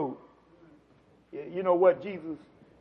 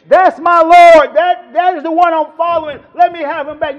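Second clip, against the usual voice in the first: loud, raised acted plea; pleading; 7 semitones higher, 15 dB louder than usual. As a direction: plead for him in a big pulpit voice. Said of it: "He wasn't there pleading".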